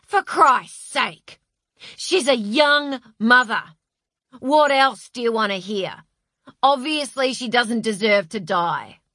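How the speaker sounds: loud, exasperated outburst; lost his temper. A narrator's reading. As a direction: shout it in frustration. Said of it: "Tony lost his temper".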